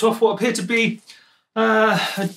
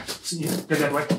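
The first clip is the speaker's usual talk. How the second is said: ordinary delivery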